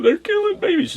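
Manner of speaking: high, shrill retold shriek of panic; half-acted frantic cry; mock hysterical scream. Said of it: "she's just screaming".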